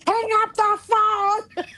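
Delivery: high and slow, spelling out each word; stern order. Retold or imitated. Mother ordering her daughter off the phone, slow high command. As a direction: lay down the law, word by word.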